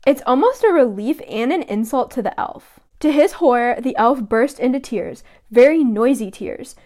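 normal talking voice

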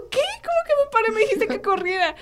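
lightly hammed-up childish confusion; mock confused child. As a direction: protest in disbelief as a little girl. Child self confused in story.

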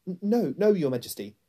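high, loud stammered denial; frightened boy. A narrator's reading. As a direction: stammer it out in alarm. Frightened boy answers the queen.

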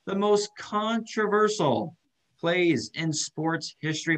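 booming ringmaster show opener. Pretend. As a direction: announce it like a circus ringmaster. Show intro announcer opening.

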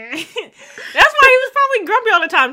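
high-pitched laughter through the words; laughing. Real, not acted. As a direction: laugh your way through the line. Real laughter mid-sentence.

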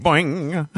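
high, drawn-out wobbling "boing"; boing sound effect. Retold or imitated. Co-host lacking a sound effect board, long high "boing". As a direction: stretch out a springy cartoon boing.

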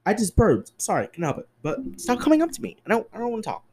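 ordinary talk, nothing marked

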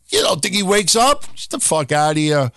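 ordinary delivery

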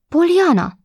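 a sudden high cry of the name; startled cry. Acted. Aunt, seeing the girl's wounds, cries out in alarm. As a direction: call out the name, caught off guard.

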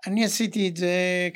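ordinary delivery

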